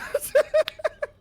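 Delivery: high loud laugh; high burst of laughter. Real, not acted. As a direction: crack up. Laughing hard.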